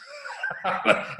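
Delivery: short breathy chuckle; amused burst of laughter; laughing. Real, not acted. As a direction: laugh lightly at your own story. Laughs at own story.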